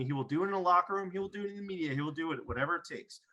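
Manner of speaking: normal talking voice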